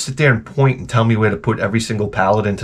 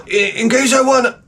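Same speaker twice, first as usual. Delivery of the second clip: mocking impersonation; 11 semitones higher than usual. Comic imitating man at barbecue, mocking high voice. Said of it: "he talks like this".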